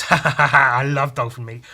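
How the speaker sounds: loud gloating villain cackle; unhinged villain. Retold or imitated. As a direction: laugh like a mad baddie.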